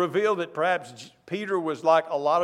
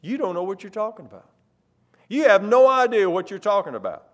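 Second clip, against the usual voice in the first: fast brush-off then louder; dismissive Peter; 2 semitones higher, 6 dB louder, faster than usual. Acted. Peter brushing off Jesus, quick then louder. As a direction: tell Jesus he has no idea. Preacher paraphrases Peter's scolding.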